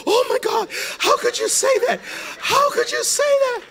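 high breathy horror; scandalized gasp. Acted. Church lady hears pastor's complaint about God, scandalized gasp.

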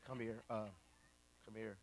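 low, very soft beckoning; hushed investigator. Retold or imitated. TV host calls client over, hushed. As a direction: quietly draw someone aside, imitating a TV host.

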